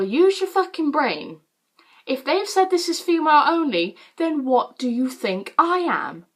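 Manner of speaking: high, emphatic comeback, slowing at the end; exasperated retort. Retold or imitated. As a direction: spell it out in mock exasperation. Exasperated retort to confused visitors.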